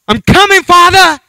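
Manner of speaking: high, loud desperate shout; panicked cry. Retold or imitated. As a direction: cry out to God mid-ride. Coaster shooting up, praying.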